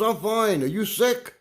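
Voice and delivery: high, loud worried question; nervous neighbor. Retold or imitated. Neighbor asks his visitor if he is sick, high and loud. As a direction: call out high, anxious about germs. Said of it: "He goes".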